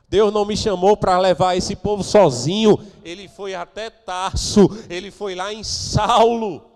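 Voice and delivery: loud, emphatic preaching, slowing on the names; pregação enfática. Real, not acted. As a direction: proclaim it forcefully to the congregation. Pregador encena Barnabé. (pregação em voz alta e enfática)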